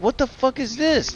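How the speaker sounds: high, shocked mock outburst; imitating the parents finding the book in a high, appalled voice; outraged disbelief. Parents finding the school book, imitated in high shocked disbelief. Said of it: "parents were like".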